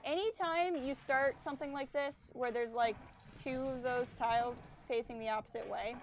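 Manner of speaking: ordinary talk, nothing marked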